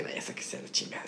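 whispered angry aside. Retold or imitated. Retelling storming out of the house, a soft whispered curse. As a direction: mutter it under your breath. Retold muttered anger, unvoiced.

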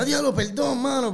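disculpa dramática y aguda; disculpa exagerada. Retold or imitated. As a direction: exagera la disculpa con alarma. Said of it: "No digas". (disculpa teatral y exagerada)

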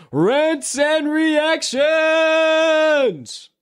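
high, drawn-out show announcer call; segment title announcer call. Acted. Host introducing the Rants and Reactions segment, high announcer call. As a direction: belt the segment name like a game show host.

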